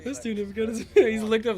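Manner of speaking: high, loud, giggly repeat. Retold or imitated. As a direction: say it again through laughter. Repeating joke louder, higher.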